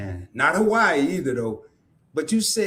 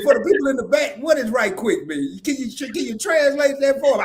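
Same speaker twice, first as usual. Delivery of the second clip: ordinary delivery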